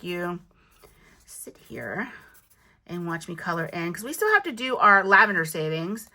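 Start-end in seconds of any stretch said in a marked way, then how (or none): 1.2-2.1 whisper. Real, not acted. Aside while not making viewers watch.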